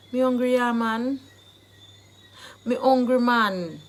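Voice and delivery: loud Jamaican patois; emphatic Jamaican patois. Shown as a demonstration. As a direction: push it hard in Jamaican patois. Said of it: "the Jamaican dialect phrase".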